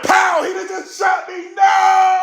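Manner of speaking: high, loud mock outcry; mock shot. Host reacting to being dissed, high loud mock outcry. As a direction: cry out as if just shot. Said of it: "I'm like".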